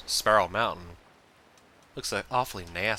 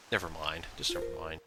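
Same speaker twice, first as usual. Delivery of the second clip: muttered; 4 semitones lower, 8 dB quieter than usual. Real, not acted. Giving up, muttered.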